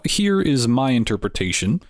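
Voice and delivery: usual speaking voice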